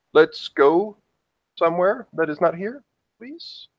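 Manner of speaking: high, rising, tentative plea; polite plea ending high and small; pleading to leave. Acted. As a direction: coax him, each phrase lifting upward.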